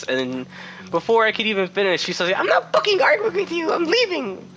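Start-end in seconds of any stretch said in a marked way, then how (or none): 2.4-4.5 very high-pitched screamed customer tantrum; mock furious screech. Customer storming out on the cashier, furious mock screech. Retold customer exploding and leaving.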